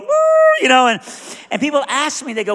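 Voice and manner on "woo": high, loud comic bawling; hammed-up crying howl; imitated group wail. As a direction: mimic a carful of people wailing. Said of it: "a car full of people going".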